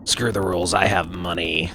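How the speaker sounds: low smug rich villain voice; Kaiba voice. Acted. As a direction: sneer it like an arrogant tycoon. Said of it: "I broke out the Kaiba voice".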